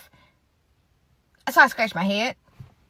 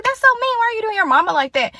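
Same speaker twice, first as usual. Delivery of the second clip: high-pitched, louder scandalized objection; indignant protest; 10 semitones higher, 4 dB louder than usual. Retold or imitated. Scolding friend over her mama, high indignant protest. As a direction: protest it high, imitating your shocked self.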